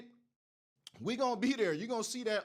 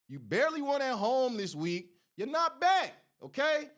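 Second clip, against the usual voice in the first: taunting shout; 6 semitones higher, 5 dB louder than usual. Real, not acted. Taunting USC fans.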